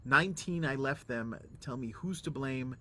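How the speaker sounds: usual speaking voice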